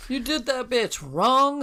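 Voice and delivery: sassy accusation. Pretend. Voicing the ghost's grudge.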